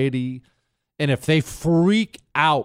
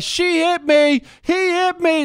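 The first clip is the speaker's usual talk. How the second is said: put-on toddler tantrum; 5 dB louder than usual. Toddler throwing himself on the ground, screaming high. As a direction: scream it like a kid throwing a fit. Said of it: "He starts screaming bloody murder".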